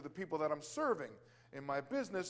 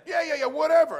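loud, high brush-off yeahs; dismissive shout; 9 semitones higher, 11 dB louder than usual. Retold or imitated. Healed customer brushing off the praise, loud dismissive whatever. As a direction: wave it away loudly, whatever. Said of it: "He says, uh".

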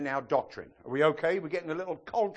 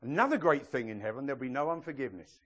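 ordinary talk, nothing marked

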